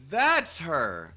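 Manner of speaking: loud sharp cry of recognition; alarmed shout. Acted. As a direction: point her out with a shout. Spotting the villain, alarm.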